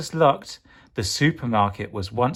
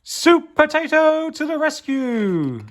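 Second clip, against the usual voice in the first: very high, slow, loud "Soup Potato"; heroic announcement; 4 dB louder than usual. Acted. Announcing Soup Potato's arrival, grand heroic call. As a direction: herald the hero.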